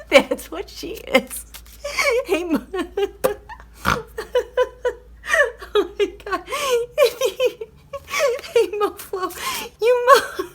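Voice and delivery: cracking herself up greeting Mo; high squeaky laughing hello; giggly high greeting. Real, not acted.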